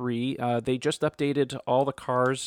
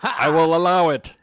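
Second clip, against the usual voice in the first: loud, higher, pompous decree; mock judge's ruling; 4 semitones higher, 10 dB louder than usual. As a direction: boom it out like a judge granting leave. Mock ruling granting the objection.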